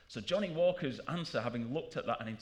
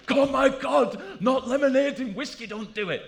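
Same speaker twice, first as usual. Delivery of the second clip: high, loud horrified cry of disapproval; outraged bar snob; 10 semitones higher, 11 dB louder than usual. Retold or imitated. Snob across the bar shrieking at a newcomer's order, high and loud. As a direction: shriek in mock horror at the lemonade.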